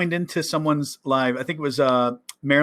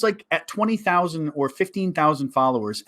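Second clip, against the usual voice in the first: usual speaking voice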